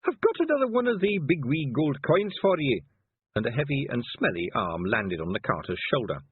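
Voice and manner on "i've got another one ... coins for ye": high, loud Scottish voice, slowed right down; a gruff little Feegle in disguise barking orders; high Scots wee man. Acted. Feegle passenger voice.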